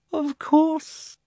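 very high, silky gloat; hateful smugness. A narrator's reading. Uncle admits planning it all, hatefully smug. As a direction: gloat through a smile, pitched high. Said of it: "with his hateful smile".

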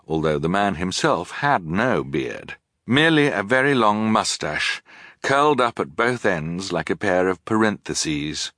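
ordinary delivery, nothing marked

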